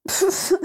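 stifled high snort of laughter; a child's snorting giggle. A narrator's reading. Sirius snickers at Régulus, stifled giggle. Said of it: "gloussa Sirius".

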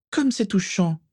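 high-pitched sneering sarcasm; taunting, falsely touched; mocking. A narrator's reading. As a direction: jeer at him in a high voice. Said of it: "railla-t-elle en regardant Lucius".

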